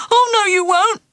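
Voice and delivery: high, loud alarmed refusal. Acted. Ralph to the teacher, refusing in alarm as he backs away. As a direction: back off in a panic.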